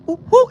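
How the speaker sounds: high hooting ooh ooh call; fake bird call. Shown as a demonstration. Storyteller showing how the fake bird call sounded, high hooting. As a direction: sound like a person faking a bird. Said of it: "it sounded like me right now".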